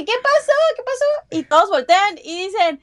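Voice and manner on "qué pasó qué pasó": a high, squeaky little fruit asking what happened; a fruit in a joke, voiced high and squeaky. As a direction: pitch way up like a tiny cartoon fruit. Joke fruit character asks.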